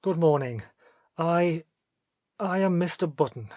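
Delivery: high, loud, halting introduction; uneasy man forcing out his name; nervous father. A narrator's reading. Nervous father introduces himself to nurse.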